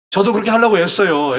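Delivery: ordinary talk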